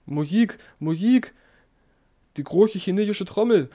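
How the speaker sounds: high, loud desperate call. A narrator's reading. Dying emperor shouts for music against the voices. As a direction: cry out for the music to drown them. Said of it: "rief er".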